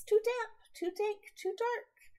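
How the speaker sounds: very high, loud squeak of a tiny mouse; squeaky mouse. Acted. Tiny mouse in the cold cave, squeaking high. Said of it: "Mouse squeaks".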